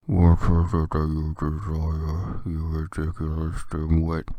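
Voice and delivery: low, slow, drawn-out sneer; cold Snape voice. Acted. Snape sneering at Ebony, slow low cold voice. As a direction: drag each word like Snape. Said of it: "in his cold voice".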